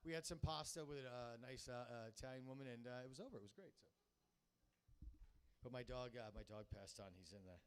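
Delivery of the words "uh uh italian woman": normal talking voice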